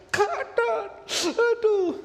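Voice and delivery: very high wailing wife in labour; istri kesakitan. Retold or imitated. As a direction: imitate a labouring wife crying out for her husband. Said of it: "Jadi ketika istri saya". (rengekan istri kesakitan, sangat tinggi)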